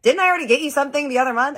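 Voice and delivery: high, loud, fast mock-whiny protest; teasing [name]. [name] teasing Kristen about his last gift, high and fast. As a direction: rattle it off in a shrill mock complaint.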